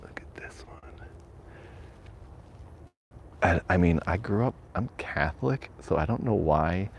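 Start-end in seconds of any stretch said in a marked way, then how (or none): 0.0-1.1 very soft, slow whisper; hushed whisper. Real, not acted. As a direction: whisper it so nobody nearby hears. Creeping through cemetery at night.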